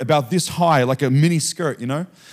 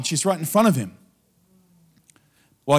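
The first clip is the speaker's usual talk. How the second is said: usual speaking voice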